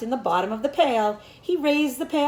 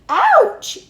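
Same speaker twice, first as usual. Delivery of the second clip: high, loud yelp of pain; scream; 12 semitones higher, 10 dB louder than usual. A narrator's reading. Pig bitten on the tail, loud high scream. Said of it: "he screamed".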